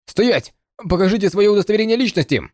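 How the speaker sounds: soldier's shout. A narrator's reading. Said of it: "войска закричали".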